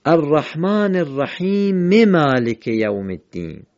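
slow recitation running "الرحیم" into "مالک" as rahime-malik; drawn-out "الرحیم مالک" in one connected phrase; verses joined. Shown as a demonstration. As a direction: sound the short e ending "الرحیم" straight into "مالک". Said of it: "اینجا حرکت کوتاه رو خواندیم".

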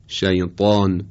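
emphatic T in «شیطان»; تلفظ درست ط. Shown as a demonstration. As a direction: correct «شیطان» with a heavy velarized ط. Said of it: "درستش هست". («شیطان» با طای پرحجم و مُطبَق)

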